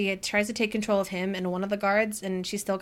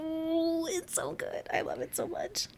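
high, soft fangirl squeal; real delight, hushed and pitched up; swooning; 7 semitones higher, 8 dB quieter, slower than usual. Real, not acted. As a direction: gush in a tiny high voice. Gushing over a favorite line.

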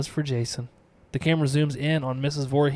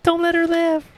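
put-on high, eerie little-kid voice; creepy child urging her on; child's voice; 4 dB louder than usual. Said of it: "She snaps out of the kid's voice".

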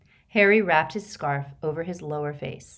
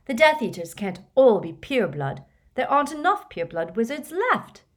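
put-on higher, louder, insistent girl's voice; stubborn Hermione; 7 semitones higher, 6 dB louder than usual. A narrator's reading. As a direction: dig in and refuse to budge. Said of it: "said Hermione stubbornly".

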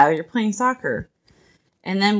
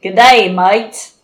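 loud, broad Australian accent; 10 dB louder, much slower than usual. Shown as a demonstration. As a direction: put on an Aussie accent, louder. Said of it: "Now say it with an accent".

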